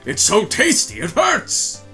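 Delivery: high ecstatic praise. Acted. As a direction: gush about the taste. Judge raving about caviar.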